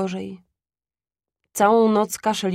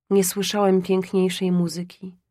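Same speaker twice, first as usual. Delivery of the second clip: usual speaking voice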